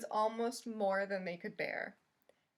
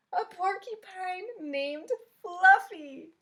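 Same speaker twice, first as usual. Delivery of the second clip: high, loud laughing howl; howling with laughter; 9 semitones higher, 10 dB louder, slower than usual. A narrator's reading. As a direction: hoot the name through big laughter. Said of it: "howled the rhinoceros".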